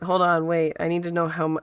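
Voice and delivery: ordinary talk, nothing marked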